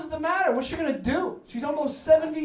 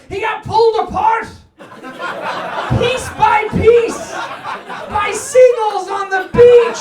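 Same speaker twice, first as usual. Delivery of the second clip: higher, louder, slow gruesome reveal; telling the crowd what happened to Rich Harper, loud and drawn out; mock dramatic shout; 9 semitones higher, 7 dB louder, slower than usual. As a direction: belt out the horrible news. Gruesome news told with glee.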